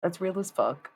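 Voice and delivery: usual speaking voice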